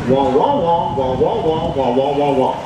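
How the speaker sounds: muted trombone wah-wah; imitating the Peanuts teacher voice; Charlie Brown adult wah. Shown as a demonstration. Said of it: "how did the bell sound in Charlie Brown".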